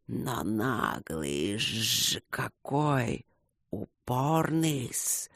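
slow, soft, drawn-out hiss; admiring serpent. A narrator's reading. Serpent admires stubborn Luke, slow soft hiss. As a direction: marvel slowly in a serpent voice. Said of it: "восхитился кто-то".

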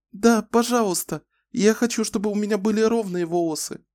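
put-on a child gladly saying yes; eager little girl. A narrator's reading. Happy child accepts haircut. (радостная девочка, высоко)

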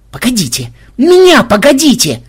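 starts ordinary, jumps to a high loud cry; wailing yell. A narrator's reading. Flying bat calls to the party to wait, yelling. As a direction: yell after them to wait. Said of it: "завопила летучая мышь".

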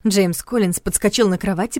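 usual speaking voice

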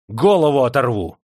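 loud, fierce threat; menacing outburst; threatening. A narrator's reading. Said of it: "пригрозил Демидов".

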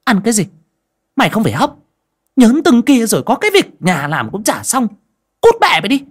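high, furious snapping; angry scolding shout. A narrator's reading. Stepmother refuses girl's plea for food, shouting angrily. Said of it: "trợn mắt lên quát".

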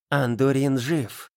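slow, solemn goddess voice; slow announcing goddess. A narrator's reading. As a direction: announce it slowly, gravely. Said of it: "объявила она Глэгу".

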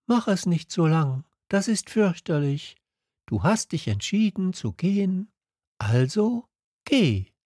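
a vain rose sending him away; lightly voiced proud rose; proud flower. A narrator's reading. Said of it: "Dann fügte sie hinzu".

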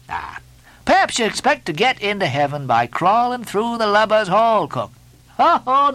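high, emphatic teasing turning to orders; Stubb, bossy mate. A narrator's reading. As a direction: hector the cook, pitched high.

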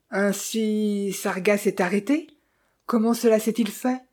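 usual speaking voice

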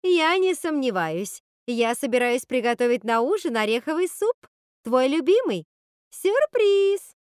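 loud, high sugary showmanship, peaking on the last word; theatrical gushing. A narrator's reading. Witch announcing supper to Rapunzel, theatrical gushing. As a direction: play it up like a stage actress, then sing out.

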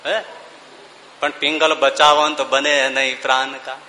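ordinary talk

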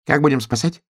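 high-pitched little hedgehog; high, questioning hedgehog voice. A narrator's reading. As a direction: ask in a small high voice. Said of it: "спросил ёжик".

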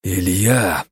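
«Илья!» процедить сквозь сжатые зубы; «Илья!» сдержанно, зубы стиснуты. A narrator's reading. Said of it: "процедил сквозь зубы я". («Илья!» цедить сквозь зубы)

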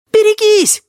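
very high, loud holler; piercing high-pitched yell; yelled warning. A narrator's reading. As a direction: shriek a warning as you charge in. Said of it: "завопил кто-то".

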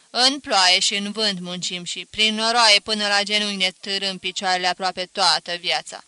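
voiced father telling of toil; father telling Ghincu of mud and rain; weary peasant father. Father speaks of hard life.

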